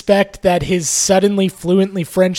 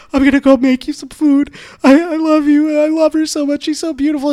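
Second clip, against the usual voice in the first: high, tearful mock sob voice, hammed-up; mock weepy breakdown; 11 semitones higher, 4 dB louder than usual. Imitating the weeping maid hugging the girl, high and tearful. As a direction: overdo the choked-up maid gushing. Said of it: "She's like".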